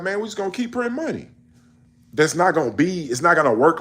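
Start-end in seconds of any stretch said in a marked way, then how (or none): 0.0-1.3 high, fast, offhand mock official; mock Greenspan quote. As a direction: rattle it off like a careless banker. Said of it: "He was like".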